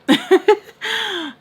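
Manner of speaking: high burst of laughter; laughing. Real, not acted. Giving up mid-story, real burst of laughter. Laughs while saying she quits.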